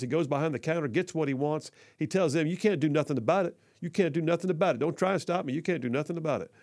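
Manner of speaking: normal talking voice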